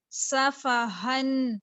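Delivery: صفحن very slow, doubly heavy صاد; slow Quranic reading. Shown as a demonstration. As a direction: stretch صفحن with a full صاد. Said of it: "صاد اس کو double پر پڑھنا ہے". (صفحن بہت آہستہ، صاد ڈبل پُر)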